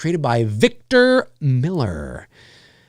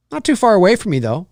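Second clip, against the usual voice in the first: normal talking voice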